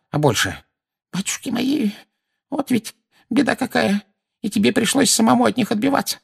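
put-on high-pitched lamenting old lady; anxious, high, grumbling voice; fretful old woman. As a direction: fret and bemoan what happened.

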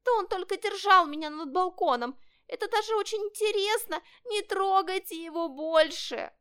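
high-pitched kitten begging; pleading kitten. A narrator's reading. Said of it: "взмолился котёнок". (котёнок умоляет тонким высоким голосом)

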